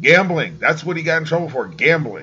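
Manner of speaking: loud, fast, furious insistence on gambling; angry insistence. Real, not acted. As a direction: hammer the word home, indignant. Angry argument about Vick's charges.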